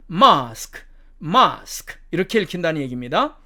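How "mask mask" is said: British "mask" with long ah; British demo of "mask"; British accent. Shown as a demonstration. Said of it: "영국식 발음으로 가면".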